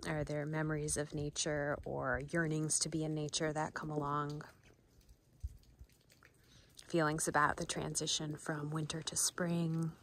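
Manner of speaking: soft, unhurried mindfulness voice; guided meditation. Acted. Guide asking listeners what comes up for them, soft meditation voice. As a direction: leave space between softly spoken invitations.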